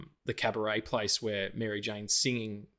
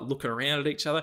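ordinary talk

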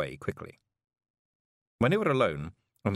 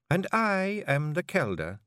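slow, a hint of hard command; cold Kelder voice; 10 semitones higher, slower than usual. Jeanie silences the men as Kelder, in a slow cold voice. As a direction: cut the air like ice. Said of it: "use your voice like that, hard, cold".

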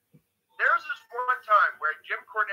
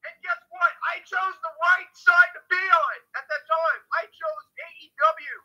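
excited gloating; 11 semitones higher, 5 dB louder than usual. Real, not acted. Gloating about the ratings win.

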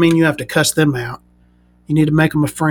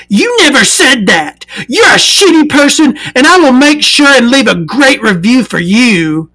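high, louder indignant voice; indignant buyer voice; 8 semitones higher, 4 dB louder than usual. Retold or imitated. Buyer threatening a bad review, high indignant voice. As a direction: threaten the review in a shrill put-on voice.

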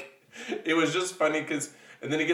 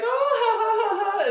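much higher, sing-song fake laugh; 6 dB louder than usual. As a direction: trill it like a carefree princess. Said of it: "she is always like".

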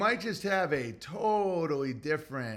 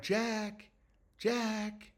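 gentle wake-up call; 4 semitones higher, 8 dB quieter than usual. Retold or imitated. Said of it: "It's all about being like".

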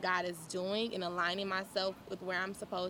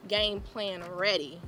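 ordinary talk

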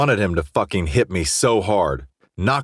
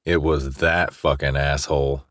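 ordinary delivery, nothing marked

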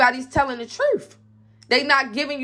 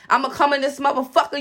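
usual speaking voice

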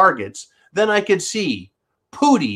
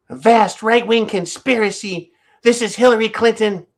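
high, put-on politician voice; hammed-up Hillary, pitched up; Hillary Clinton impression; 7 semitones higher than usual. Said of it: "Let's see, Hillary Clinton's voice".

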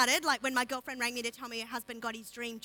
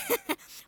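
short high cackle of glee; giddy little laugh; high gleeful laugh. Retold or imitated. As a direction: let a smug laugh escape high. Laugh inside Haman's quote.

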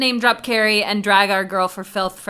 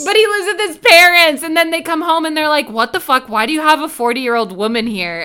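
excited laughing retelling; 5 semitones higher, 9 dB louder than usual. Retold or imitated. Excited retelling of the parents' scene.

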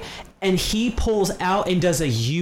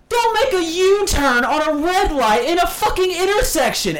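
furious yell, high and loud; angry shout; 12 semitones higher, 4 dB louder than usual. Retold or imitated. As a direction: yell it in his face. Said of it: "And I went".

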